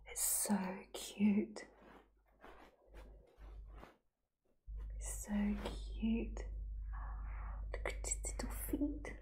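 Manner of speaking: breathless whisper over something adorable; whispered gushing. Real, not acted. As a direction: whisper it, melting at the cuteness. Gushing over a cute toy.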